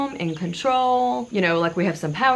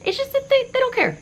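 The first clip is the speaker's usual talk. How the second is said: much higher, exasperated complaint. Real, not acted. Exasperated rant about messy kids.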